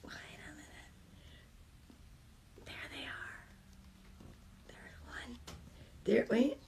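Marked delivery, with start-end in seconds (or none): 0.0-5.4 whisper. Real, not acted.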